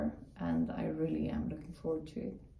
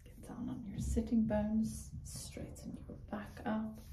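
whispered yoga guidance; 4 semitones higher, 7 dB quieter than usual. Acted. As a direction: guide them gently in a whisper.

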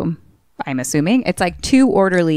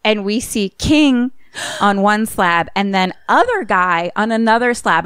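usual speaking voice

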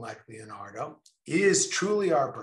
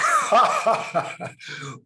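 laugh; 6 semitones higher, 6 dB louder than usual. Real, not acted. Laughs at own point about work.

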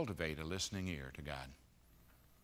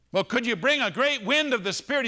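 high, loud begging; imploring shout, much higher; loud pleading prayer; 13 dB louder than usual. Pretend. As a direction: cry out to heaven for a sign. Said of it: "what we're doing is saying".

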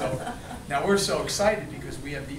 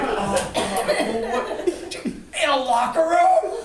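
higher and louder, laughing through it; flustered disbelief; 9 semitones higher, 8 dB louder, slower than usual. Retold or imitated. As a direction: play your younger self caught out and panicking. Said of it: "And I'm standing there like".

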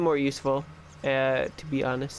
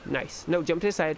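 usual speaking voice

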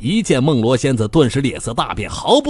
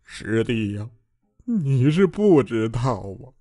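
slow, heartbroken complaining; tearful lament; 3 semitones higher than usual. A narrator's reading. Bai Jianfei telling his junior brother his woes, slow and tearful. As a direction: pour out your sorrow, rubbing your eyes. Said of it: "白剑飞揉着眼睛说得老伤心了".

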